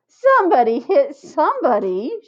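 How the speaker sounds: loud eager shout. A narrator's reading. Lori egging on the fight, loud eager shout. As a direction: egg them on. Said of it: "shouted Lori".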